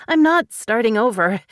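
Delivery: firm denial, leaning hard on not; emphatic. A narrator's reading. Cassie firmly tells the women she's not starting over, emphatic. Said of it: "Cassie said emphatically".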